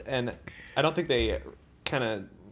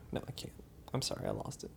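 ordinary delivery

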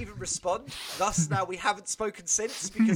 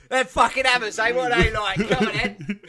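loud, high mock fan shouting; rowdy football banter; 5 semitones higher, 7 dB louder than usual. New school worker yelling football banter at reception, mock rowdy shout. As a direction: goad like a rowdy supporter.

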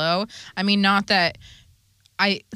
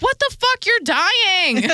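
put-on high, loud panicked teen shriek; mock panic, high and loud; hammed-up Dawn panic; 13 semitones higher, 5 dB louder, slower than usual. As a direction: shriek it like a frightened kid sister. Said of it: "Dawn's like".

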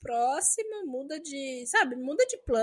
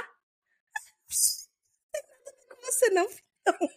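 teasing sing-song; 8 semitones higher, slower than usual. Retold or imitated. Teasing reply to husband João.

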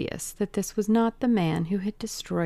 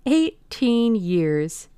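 slow, louder, gloating repeat; triumphant repeat; 4 semitones higher, 5 dB louder, much slower than usual. Acted. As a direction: stretch each word out, sure he has won. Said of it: "with a touch of triumph in his voice".

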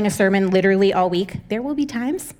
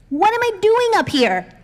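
exasperated high whine; 10 semitones higher than usual. Real, not acted. Exasperated self-mockery.

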